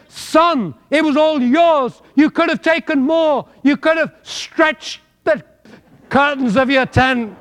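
a hint of Cockney, preached high and loud; mock Cockney. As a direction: play God as a Cockney. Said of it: "I'm speaking Cockney".

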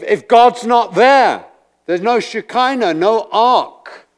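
high, loud, impassioned sermon; preacher pours out passion to the congregation; preaching at full force. Acted. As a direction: thunder it from the pulpit.

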